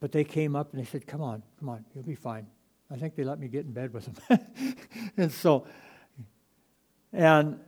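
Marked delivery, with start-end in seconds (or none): 1.1-2.5 quiet, gentle coaxing; calm a scared five-year-old; soothing parent. Retold or imitated. Parents coaxing their frightened little boy out, soft and soothing.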